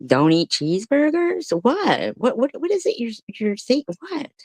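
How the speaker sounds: high, rising disbelief; what are you even saying; incredulous. Real, not acted. Incredulous at hint.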